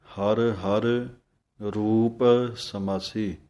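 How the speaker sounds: very slow ਹਰ ਹਰ ਰੂਪ ਸਮਾਸੀ, each word apart; words kept separate. Shown as a demonstration. Teacher showing students to say ਹਰ ਹਰ separately, slow. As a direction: space out ਹਰ ਹਰ and ਰੂਪ ਸਮਾਸੀ deliberately. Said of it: "ਵੱਖਰਾ ਬੋਲਣਾ ਹੈ".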